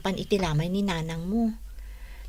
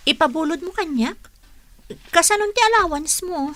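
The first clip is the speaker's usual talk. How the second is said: high, startled question; girl surprised her friend can lend money; 7 semitones higher than usual. Acted. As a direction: ask in disbelief at the offer.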